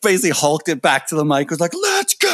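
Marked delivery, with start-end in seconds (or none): 1.7-2.3 very high, stretched stage yell; re-enacted frontman battle cry; rallying shout. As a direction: holler it to a crowd from the mic.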